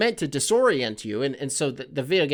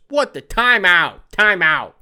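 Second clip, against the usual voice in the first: louder, slowed "time out" call; panicked exterminator; 3 semitones higher, 6 dB louder, slower than usual. Retold or imitated. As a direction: stop everything, half-shouting. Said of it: "are they gonna be like".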